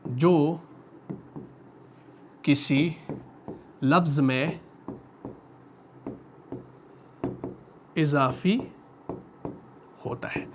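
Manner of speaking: slow, word by word with long pauses for note-taking; slow dictating pace, last words almost whispered; slow dictation. Shown as a demonstration. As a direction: dictate it slowly, pausing between words. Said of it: "ठीक, हमजा जायदा".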